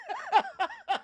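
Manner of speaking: a quick high-pitched giggle; high amused giggle. Real, not acted. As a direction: burst into a squeaky laugh. Laugh after funny name.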